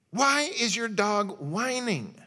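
loud, slow, exasperated question; an annoyed pastor loudly asking why the dog whines. Retold or imitated. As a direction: demand it over the whining dog. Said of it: "He said".

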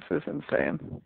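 normal talking voice

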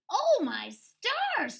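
high, loud, slow squeal; shocked pig exclaiming. A narrator's reading. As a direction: gasp like a startled pig. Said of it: "squealed Roly Pig".

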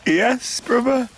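normal talking voice

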